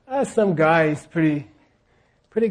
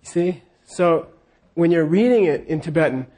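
ordinary talk

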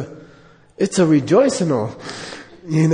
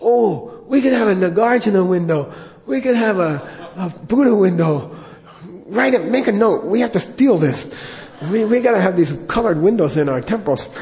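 higher and bubbling with laughter; excited Lama; 4 semitones higher than usual. Retold or imitated. Lama inspired by cathedral windows, gleeful. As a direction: gush over the stained glass ideas. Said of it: "Rinpoche voiced in story".

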